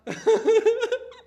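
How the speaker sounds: high embarrassed laugh; high embarrassed giggle; burst of laughter. Real, not acted.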